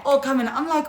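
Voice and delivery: ordinary delivery, nothing marked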